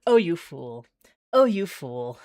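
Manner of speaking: hammed-up groan at a fool; mock dismay. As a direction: scold a foolish ally, hammed up. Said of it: "I'm like".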